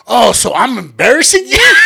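loud, high, wounded comeback; hammed-up hurt indignation; offended. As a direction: act insulted and throw it back. Brother acts offended, loudly.

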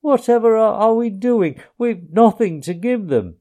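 despairing Mole. A narrator's reading. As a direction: panic that there is nothing to give. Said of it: "he cried in despair".

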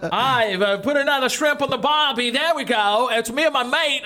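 a second, sillier go at an Aussie bloke; mock Aussie voice, well above his own; Australian accent. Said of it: "please try again".

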